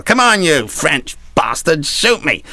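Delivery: high, slow battle dare; hammed-up challenge, drawn out; defiant taunt. Patched-up captain back in battle daring the French, mock-defiant call.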